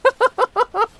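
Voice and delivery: high, loud giddy laugh; delighted squealing laugh. Real, not acted.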